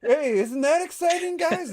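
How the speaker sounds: high, loud fake-cheerful host voice; squeaky overexcited stage voice; mock hype presenter. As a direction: ham up a presenter begging for cheers. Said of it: "and they're like".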